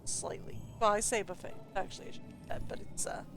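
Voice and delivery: soft whispered side remark; whispered aside. Real, not acted. Aside about the buffet table.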